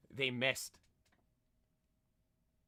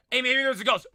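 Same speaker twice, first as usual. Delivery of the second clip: louder and higher, playful; mock spooky tease; 7 semitones higher, 6 dB louder, faster than usual. Streamer teasing chat about the noise, louder playful. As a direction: call it out teasingly. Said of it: "repeated louder".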